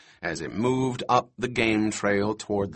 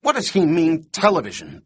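higher, louder indignant question; furious; 5 semitones higher, 4 dB louder than usual. A narrator's reading. Doctor baffled by the kid's words, angry question. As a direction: demand it crossly, dropping at the end. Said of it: "He was furious".

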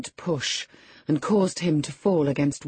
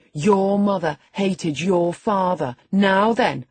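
put-on slow, loud, higher boyish jab; spiteful taunt; 5 semitones higher, 6 dB louder, slower than usual. A narrator's reading. Boy telling his cousin of her mother, loud and taunting. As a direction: land the taunt triumphantly.

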